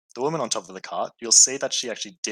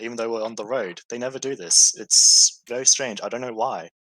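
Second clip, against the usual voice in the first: shaken Samantha; 3 semitones higher than usual. Acted.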